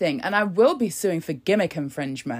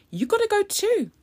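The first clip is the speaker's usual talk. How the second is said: squeaky high sing-song, hitting gotta; high taunting you gotta go too; high mock scold; 12 semitones higher than usual. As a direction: tell her off in a high mocking voice.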